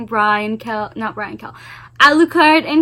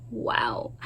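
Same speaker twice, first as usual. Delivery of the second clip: awed whisper; 13 dB quieter than usual. Real, not acted. Awed reaction to book scene.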